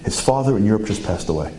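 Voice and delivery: ordinary talk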